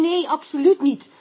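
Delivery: loud, high-pitched protest of innocence; emphatic denial. Acted. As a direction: insist hard that you know nothing. Suspect denies under interrogation.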